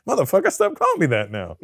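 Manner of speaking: high, loud exasperated protest; comic outraged retort; mock indignation. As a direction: play up being fed up with the teasing. Acted retort to teasing plumbers.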